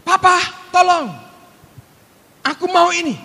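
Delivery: put-on high, loud little child calling to his papa; small boy pleading to his father for water; child crying out for help. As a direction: call out like a toddler who can't reach the cup. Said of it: "Terus dia teriak".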